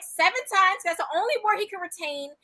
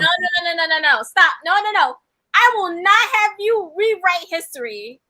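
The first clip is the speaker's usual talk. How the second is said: loud, raised mock-outrage; indignant objection; 3 semitones higher, 8 dB louder than usual. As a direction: cut in loudly and forbid it. Co-host objects to the retelling.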